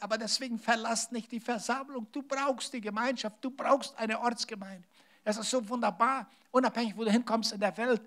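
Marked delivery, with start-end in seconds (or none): 0.0-4.9 a lightly voiced apostle, pleading; quoting Apostle Paul. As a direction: lift the voice to voice Paul's appeal to the church. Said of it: "der Apostel Paulus sagt".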